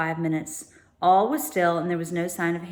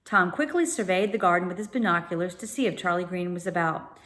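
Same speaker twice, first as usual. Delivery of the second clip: plain reading voice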